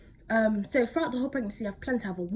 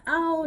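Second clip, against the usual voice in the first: mild ow; 7 semitones higher, 6 dB louder than usual. Retold or imitated. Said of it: "I'm like".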